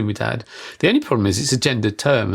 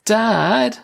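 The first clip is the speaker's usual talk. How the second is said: a light imitation of a small child calling dad; little girl calling; 11 semitones higher than usual. Child calls her parent through a toilet door, lightly voiced. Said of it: "I heard this little voice from outside the door saying".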